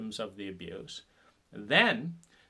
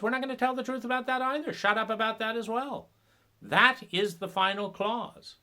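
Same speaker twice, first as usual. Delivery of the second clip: higher, sneering voice of the cult crowd; mock cult voice; 8 semitones higher, 3 dB louder than usual. The shaming crowd scolding the one who spoke up, mock scornful. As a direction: lay down the cult's rules with scorn.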